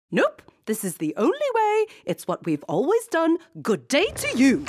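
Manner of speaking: very high, loud yell, slowing at the end; queen throwing princess out. A narrator's reading. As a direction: yell her out the door. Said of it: "the queen yelled".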